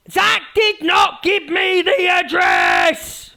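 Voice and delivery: slow, high shouted mimicry of a ranting streamer; impression of Easy. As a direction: yell it out slowly like an outraged streamer. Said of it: "that was my impression of Easy".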